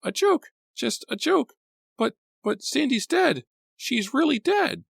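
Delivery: high panicked cry; shrill, high, frightened cry; shrill trembling cry. A narrator's reading. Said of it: "shrill, trembling voice".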